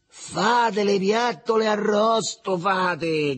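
high, drawn-out yell; loud, high, stretched taunt; gleeful shout. A narrator's reading. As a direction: yell it out, relishing your own joke. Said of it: "gridò, schiattando da ridere".

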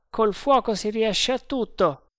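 high-pitched sergeant voice, lightly acted; resolute sergeant. A narrator's reading. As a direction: say it high and sure. Voce del sergente Bharata nel dialogo. (voce acuta e decisa da sergente)

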